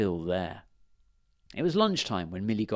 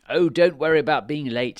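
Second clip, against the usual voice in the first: higher, louder reassuring king voice; fairy king; 4 semitones higher, 6 dB louder than usual. A narrator's reading. Fairy king reassures Millie she won't be late: higher, louder. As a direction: soothe the girl from on high. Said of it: "said the fairy king".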